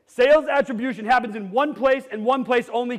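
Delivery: ordinary talk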